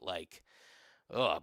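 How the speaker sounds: usual speaking voice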